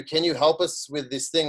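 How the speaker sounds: ordinary talk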